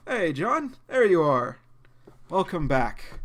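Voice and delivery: loud, high welcoming dad; warm dad greeting. Acted. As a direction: welcome him home.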